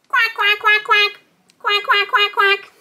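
high, rapid-fire quacks; duck quacking. Acted. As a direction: quack like a little toy duck. Imitates the toy duck.

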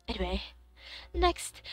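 normal talking voice

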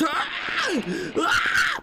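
high-pitched panicked scream; real high scream of alarm. Real, not acted. Player attacked by an insect, screaming high.

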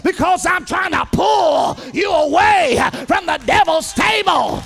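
full-force sermon cadence; fiery preaching. Acted. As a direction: preach it hard to the congregation.

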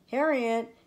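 father searches for his daughter, calling loudly. A narrator's reading. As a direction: call for her from room to room. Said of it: "called Franklin's father".

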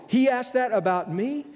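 can't believe what you heard; astonished. Pretend. Astonished at Jesus's answer.

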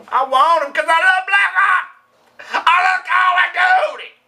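very high, hammed-up gushing; mock pleading. Husband talking his wife into birthday games, mock pleading up high. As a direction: strain way up high like an eager kid.

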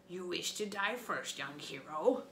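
menacing giant taunt. A narrator's reading. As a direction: sneer down at the little hero.

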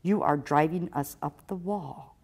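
ordinary delivery

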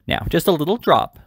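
high, coaxing urging; persuasive. A narrator's reading. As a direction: lift the pitch and coax the guest. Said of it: "said Missus Mann persuasively".

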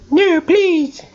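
very high mock-begging squeak; squeaky pleading. Player begs the game for mercy, squeaky pleading.

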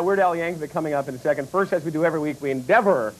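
smooth low segment intro; announcer read. Acted. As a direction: present it like a show host.

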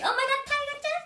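excited calling. Real, not acted. Calling her cat excitedly.

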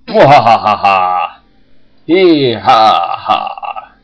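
loud evil cackle, slowing down; mad scientist. Pretend. Villain cackle after killing pieces.

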